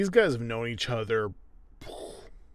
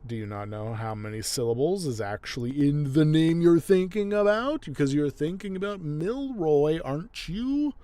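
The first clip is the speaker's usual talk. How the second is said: rising higher and louder, mock indignant questioning; retold exasperation; 4 semitones higher, 4 dB louder than usual. Neely berating clueless Alan over syllables, mock exasperated. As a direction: imitate her scolding him as she climbs in pitch. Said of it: "she's like, "Are you an idiot?".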